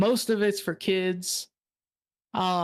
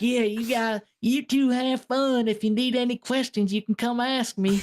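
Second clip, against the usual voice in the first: higher-pitched imitation of a friendly Southern owner; Southern accent; 5 semitones higher than usual. Owner offers visitors his help, in a Southern accent. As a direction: mimic a helpful Southern host seeing guests off. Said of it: "He talked like this".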